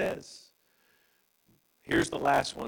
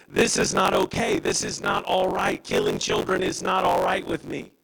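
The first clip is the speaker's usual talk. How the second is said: forceful preaching voice; 7 semitones higher, 5 dB louder than usual. Acted. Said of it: "And Jesus says".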